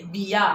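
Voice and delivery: बिया said quickly with short i; बिया, the bi kept brief; quick short vowel. Shown as a demonstration. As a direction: say बिया in one brisk go. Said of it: "आपण पटकन म्हणतो".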